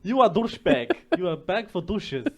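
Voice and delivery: loud, high mock Russian insult; Russian accent. Hamming a Russian accent to his co-host, loud. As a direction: play it as a broad Russian. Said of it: "You sound Russian".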